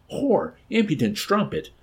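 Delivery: high-pitched raging insult; furious shout. A narrator's reading. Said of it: "he shouted".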